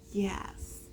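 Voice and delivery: soft unvoiced whisper. Real, not acted. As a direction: hush it like a bedtime goodnight. Bedtime whisper to child.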